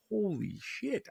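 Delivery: stunned under the breath; shocked. Retold or imitated. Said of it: "and I was like".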